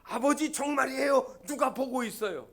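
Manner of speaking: high, insistent loud call; crying out. Acted. Son insists to father someone is watching, crying out. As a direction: call out high to convince him. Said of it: "또 소리를 지릅니다".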